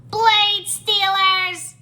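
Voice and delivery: high, loud, very drawn-out squawk; shouting gecko. A narrator's reading. Gecko insults the monks, high angry shout. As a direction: stretch the insult in a high creature yell. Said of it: "another gecko shouted".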